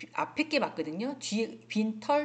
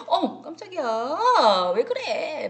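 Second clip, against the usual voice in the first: loud, high, drawn-out mock surprise; startled; 6 semitones higher, 7 dB louder, slower than usual. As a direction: act startled, stretching the words out high. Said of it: "놀랬을 때". (크고 높게 늘여서 놀란 척)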